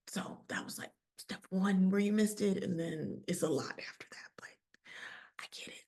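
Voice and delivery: a quiet, apologetic aside; sheepish whisper. Acted. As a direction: whisper the correction under your breath.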